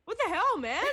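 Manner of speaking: high, loud what-the-hell shout; incredulous outburst. Retold or imitated. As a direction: react like a real person, startled. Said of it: "I'm just like".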